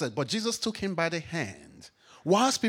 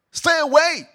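shouted rebuke; 9 semitones higher, 10 dB louder than usual. Acted. Preacher rebuking the devil.

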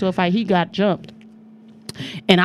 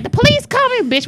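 imitated alarmed warning; 14 semitones higher, 4 dB louder than usual. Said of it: "they was like".

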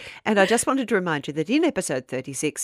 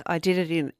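ordinary talk, nothing marked

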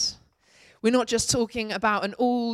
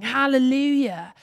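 louder ringing hallelujah; shouted hallelujah; 5 semitones higher, 6 dB louder than usual. A narrator's reading. Voicing the great multitude in heaven, a raised shout. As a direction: let the multitude's shout ring out.

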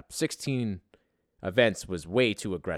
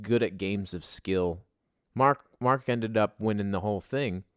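ordinary delivery

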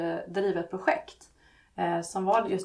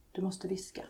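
hushed, softer whisper; whispered warning; 6 dB quieter than usual. A narrator's reading. Laxmi warns the child next door, whispering. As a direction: drop into a whisper to warn.

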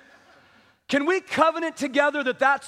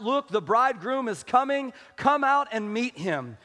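normal talking voice

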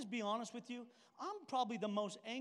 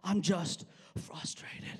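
slow, half-whispered, held-in frustration; strained self-calming; 3 semitones lower, 5 dB louder, much slower than usual. Retold or imitated. Trying to calm himself before his friend, strained restraint. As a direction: force it out slowly as if holding back anger. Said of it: "I'm trying to calm myself".

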